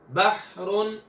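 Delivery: बहरुन with a rough ha rubbed from mid-throat; Arabic ha from the middle of the throat; throaty ha. Shown as a demonstration. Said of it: "हलक के दरमियान में से रगड़ खा के".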